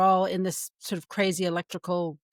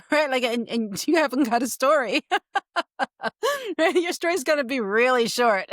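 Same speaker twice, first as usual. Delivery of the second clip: talking through real laughter, higher and louder; laughing; 4 semitones higher, 4 dB louder than usual. Real, not acted. Writing teacher jokes about a story cut short, laughing. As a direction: let the laughter break into the words. Amused at own point, laughs heard.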